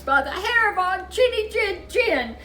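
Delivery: tiny wolves chanting back at the pig; squeaky little wolves. Acted. Wolves defying the pig again.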